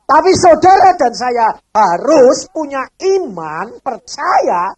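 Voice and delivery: loud, slow sermon exhortation; booming pulpit voice, slow; 1 full-force preaching. Real, not acted. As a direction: drive the point home to the congregation.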